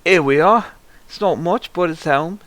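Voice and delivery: high, lilting auntie voice; chirpy village auntie at the door; welcoming auntie. Acted. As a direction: usher guests in with a high lilt.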